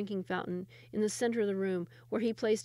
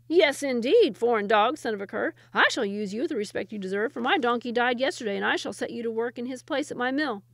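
loud, sneering mill owner; mocking miller; 3 semitones higher, 8 dB louder than usual. The miller mocks the Sultan, loud and sneering. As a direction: sneer down at the foreign dog.

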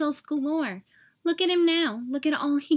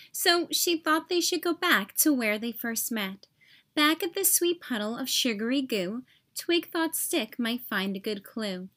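ordinary delivery

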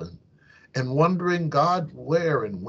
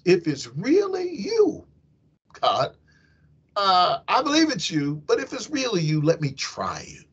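pleading Peter; 6 semitones higher than usual. Retold or imitated.

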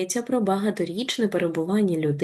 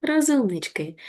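ordinary talk, nothing marked

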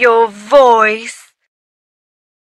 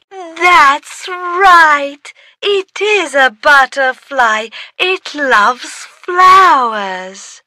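ordinary talk

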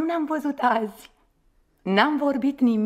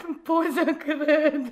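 very slow words through laughter; laughing; 4 dB quieter, much slower than usual. Acted. Woman can't believe her lover, laughing. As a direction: squeeze the words out between giggles. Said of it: "Mona, de ce râzi?".

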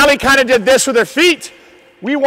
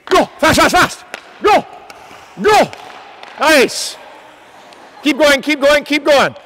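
shouting encouragement; 6 semitones higher, 4 dB louder than usual. Real, not acted. Coach urging skaters on.

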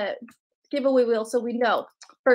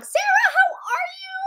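much higher, thrilled hello to Sarah; excited greeting. Real, not acted. Delighted greeting to a viewer.

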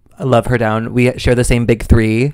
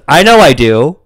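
higher, louder put-on self-assured retort; mock cocky; 4 semitones higher, 6 dB louder than usual. Contestant told she has talent, mock cocky. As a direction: imitate a contestant sure of her talent.

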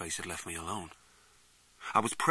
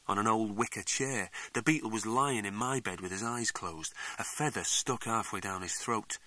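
ordinary delivery, nothing marked

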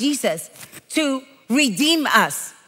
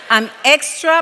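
usual speaking voice

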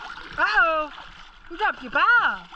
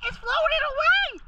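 high voice; 11 semitones higher than usual. Real, not acted. Warning dog about ball.

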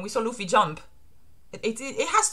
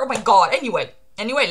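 ordinary talk

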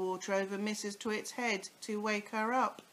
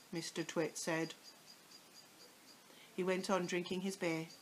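usual speaking voice

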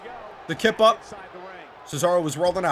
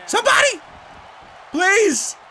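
high, loud pleading shout; desperate call for help; 12 semitones higher, 3 dB louder, slower than usual. Real, not acted. Player pinned in the game, shouting for a teammate to save him. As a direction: yell for rescue, half joking. Begging for help during match.

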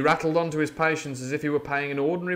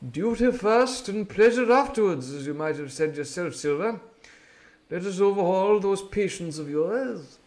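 a measured doctor keeping his dignity; stern doctor; 6 semitones higher than usual. A narrator's reading. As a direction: speak with cool authority.

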